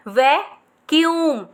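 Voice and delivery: Vacuum drawn out slowly; slow model word. Shown as a demonstration. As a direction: say it slowly for them to copy. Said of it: "जैसे मैंने बोला है". (धीरे से model करो)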